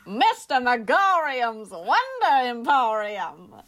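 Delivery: slow transatlantic accent, Mister Megorium's Wonder Emporium drawn out; old Hollywood transatlantic accent, slow. Shown as a demonstration. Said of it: "let's hear it".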